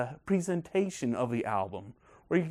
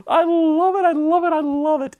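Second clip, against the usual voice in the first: high, loud, laughing I love it; laughing delight; 11 dB louder, slower than usual. Real, not acted. Fan laughing over the governor's line, high and loud. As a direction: squeal it through a laugh. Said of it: "I'm too happy".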